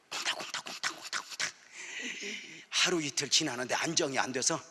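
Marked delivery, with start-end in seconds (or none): 0.1-1.5 much softer breathy whisper; whispered heartbeat. Retold or imitated. Pastor whispers his racing heart again, hushed. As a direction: hush it like a fluttering heart.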